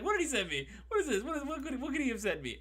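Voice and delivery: high squeaky frightened mimicry of the streamer; mock scared voice. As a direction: whimper it in a thin, high, laughing panic. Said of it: "Solid impression".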